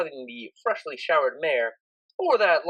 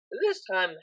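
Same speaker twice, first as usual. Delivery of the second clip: usual speaking voice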